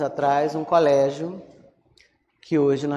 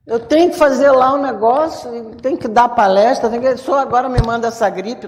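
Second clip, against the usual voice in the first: higher, louder, indignant protest; mock complaint to God; 5 semitones higher, 5 dB louder, faster than usual. Flu sufferer protesting to God before a lecture, mock complaint. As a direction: grumble at God in hammed-up exasperation. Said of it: "eu digo".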